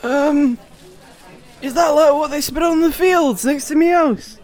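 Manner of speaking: put-on high wheezy old Yorkshire farmer accent; old Yorkshire farmer, wheezing and high. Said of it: "a wheezing old Yorkshire farmer".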